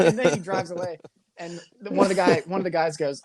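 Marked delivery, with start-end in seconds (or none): none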